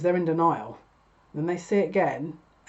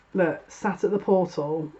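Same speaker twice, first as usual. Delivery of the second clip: ordinary talk, nothing marked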